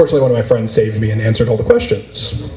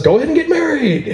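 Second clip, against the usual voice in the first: Canada's cheerful offer. Retold or imitated. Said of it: "Canada says".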